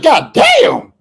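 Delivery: loud, high shocked "God damn"; stunned outburst at the news; shocked exclamation. Retold or imitated. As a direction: blurt it out in disbelief. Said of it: "I was like".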